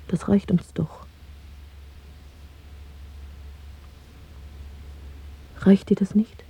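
hushed plea to her lover; whisper. Acted. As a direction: whisper it close, coaxing him. Lovers talk secretly in bed.

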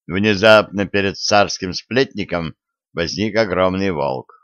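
ordinary delivery

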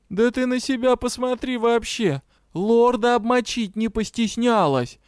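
high-pitched outraged retort; indignant. A narrator's reading. Dragon rebuking the kitten, indignant. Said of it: "возмутился Навер".

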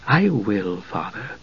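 soft, patient reply. A narrator's reading. Palin patiently answering his grieving father, soft. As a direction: calmly humor him. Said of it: "Palin said patiently".

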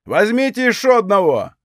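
a gleeful call from the doorway; cheerful shout. Acted. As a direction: shout it out merrily. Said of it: "весело крикнул".

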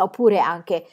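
plain reading voice

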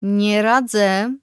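very slow, drawn-out warning; measured word of warning; cautioning. A narrator's reading. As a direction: stretch it out as a caution. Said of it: "uprzedziłam".

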